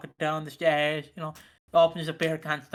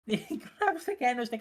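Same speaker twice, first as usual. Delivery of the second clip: ordinary delivery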